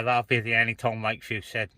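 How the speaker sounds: ordinary talk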